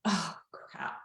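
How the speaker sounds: low, hushed dismay; quiet, deflated oh no; retold sinking realization. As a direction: let it drop softly as it dawns on you.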